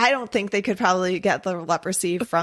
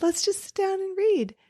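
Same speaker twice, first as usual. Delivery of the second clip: high, light put-on voice; mock casual invitation, pitched high; imagined ghost conversation; 13 semitones higher than usual. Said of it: "Like, there's a ghost".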